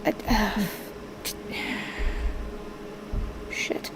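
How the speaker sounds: hushed, breathy little curse under the breath; whispered curse. Acted. Bird failing to open the door, cursing in a whisper. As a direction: let it slip out as a whisper. Bird fails to pull string.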